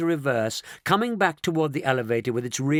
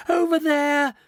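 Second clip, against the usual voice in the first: put-on high, loud hysterical old lady's cry; hysterical scream; 5 dB louder than usual. A narrator's reading. Grandma pointing at the canid through glass, hysterical shriek. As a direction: shriek it, beside herself with fear. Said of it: "high hysterical note in the old lady's voice".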